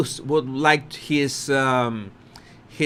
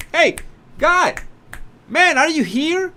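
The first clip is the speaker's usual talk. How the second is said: pitched-up bewildered "hey, are you there"; dazed mock call; 10 semitones higher than usual. Mocking Harry as lost, high and bewildered. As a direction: play someone snapping out of a blank.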